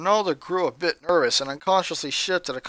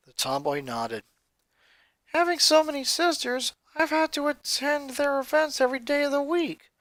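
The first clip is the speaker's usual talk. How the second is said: put-on higher boy's voice, troubled; a young boy telling his story, raised pitch; Lincoln's voice; 8 semitones higher than usual. Boy confiding to his friend, higher boyish voice.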